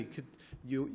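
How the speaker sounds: ordinary talk, nothing marked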